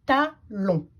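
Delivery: "talon" in two separate beats; syllable by syllable. Shown as a demonstration. Teacher reading what remains of the word, "talon" split in two. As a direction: leave a gap between the syllables of "talon". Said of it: "je vais retirer ma première syllabe".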